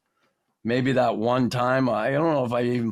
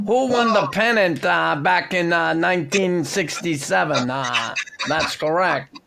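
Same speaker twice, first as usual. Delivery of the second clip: higher, louder impression of a trivia-loving prof; Ron Caron; 5 semitones higher, 3 dB louder than usual. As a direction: quiz the room in a put-on voice, drawn-out "uh"s. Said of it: "The prof, he wanted".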